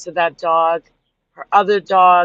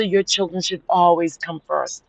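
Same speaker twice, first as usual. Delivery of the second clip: normal talking voice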